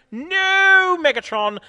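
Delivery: very high, loud protest; annoyed yell. A narrator's reading. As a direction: yell in exasperation. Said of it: "Edison yell, annoy".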